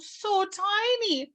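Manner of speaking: high, drawn-out coo over something tiny; tender cooing. Real, not acted. Reader marvelling at the tiny chick, cooing.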